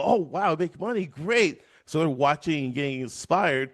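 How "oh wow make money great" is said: excited viewers. Retold or imitated. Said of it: "And then people go".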